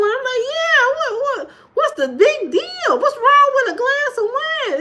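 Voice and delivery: high-pitched laughing mock protest; exaggerated high voice, amused disbelief; high squeaky retelling of herself. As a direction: squeal it out, incredulous and giggly. Retold self answering friend at bar.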